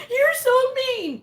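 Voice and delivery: high, loud, slow whine; mock whiny viewer. Vlogger mimicking viewers who complain about her attitude, high drawn-out whine. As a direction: whine it like a hurt fan. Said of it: "some of you hate my attitude because you say".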